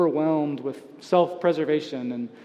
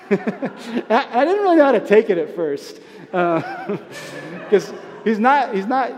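laughing; 5 semitones higher, 5 dB louder than usual. Real, not acted. Laughing while recalling neighbor's remark.

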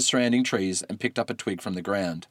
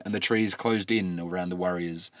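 usual speaking voice

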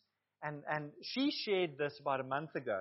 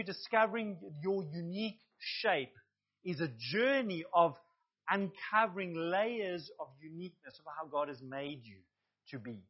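ordinary delivery

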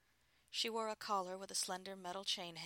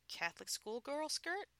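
husband's hopeful guess; 10 semitones higher, 4 dB louder than usual. A narrator's reading. Husband voiced by female narrator.

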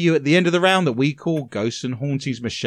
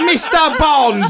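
mock spy villain; 8 semitones higher, 4 dB louder than usual. Mock Russian spy villain after Russia jibe.

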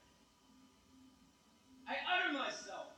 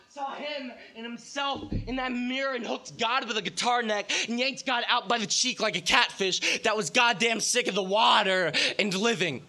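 building to a shout; 12 dB louder than usual. Acted. Poem builds to a climax.

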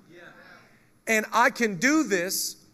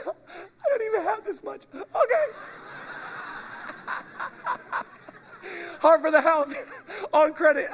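helpless laughter; 9 semitones higher than usual. Real, not acted. Overcome laughing at the pulpit.

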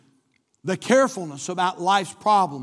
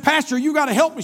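higher, louder desperate plea; frantic plea; 8 semitones higher, 7 dB louder than usual. Retold or imitated. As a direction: act out a shaken woman begging for help. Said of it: "she was shaken and shaking".

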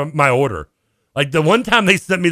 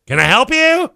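put-on suspicious older lady; 13 semitones higher, 5 dB louder than usual. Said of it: "It's an older lady".

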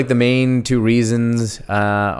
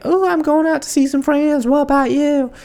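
very high mock woman's voice; squeaky-high caricature of a texting date; put-on accent. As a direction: put on a silly falsetto accent. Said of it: "I don't know why I did that accent".

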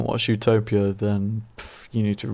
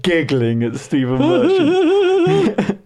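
much higher, louder, slow, giggling through the words; Ricky Gervais giggle; 11 semitones higher, 4 dB louder, much slower than usual. Retold or imitated. Friend mimics Gervais giggling across the island, slow high giggle. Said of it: "You just get Ricky Gervais".

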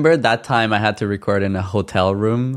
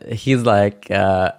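ordinary delivery

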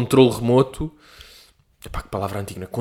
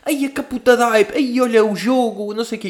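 put-on excited kid voice; 12 semitones higher than usual. Imitating excited kid on stream.

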